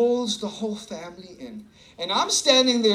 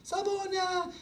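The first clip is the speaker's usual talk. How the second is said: granny's greeting; 11 semitones higher, 6 dB quieter than usual. Retold or imitated. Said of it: "she's like".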